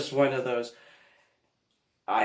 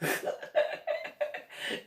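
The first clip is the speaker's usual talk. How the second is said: high burst of laughter; helpless giggling; real laugh; 5 dB quieter than usual. Real, not acted. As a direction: crack up at your own story. Laughing at own story.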